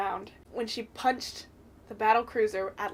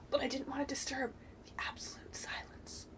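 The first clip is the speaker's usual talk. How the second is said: whisper; 3 semitones higher, 11 dB quieter than usual. Real, not acted. Recalling silent theater moment.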